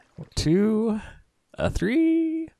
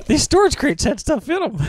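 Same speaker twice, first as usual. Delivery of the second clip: excited; 6 dB louder than usual. Real, not acted. Sudden high excited line.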